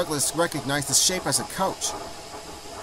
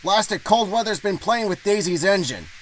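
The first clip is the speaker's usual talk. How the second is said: higher-pitched driver voice cursing the cold; grumbling engine driver; 7 semitones higher than usual. Acted. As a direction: explain a breakdown as an annoyed driver.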